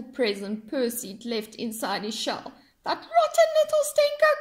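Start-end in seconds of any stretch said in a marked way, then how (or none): 2.8-4.3 high, loud squawking crab; crab crying out. Acted. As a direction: screech it like an outraged old crab. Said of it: "cried the crab".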